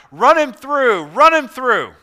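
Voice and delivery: high, loud crowd cry; crowd's shout. Acted. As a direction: shout it like a bloodthirsty mob. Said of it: "they began to shout".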